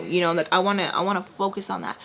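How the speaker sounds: ordinary talk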